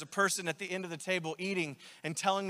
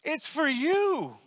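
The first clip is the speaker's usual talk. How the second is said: inviting voice of God; 7 semitones higher, slower than usual. Retold or imitated. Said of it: "God was inviting me to".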